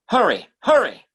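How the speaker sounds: urgent. Acted. Urging her to come quickly.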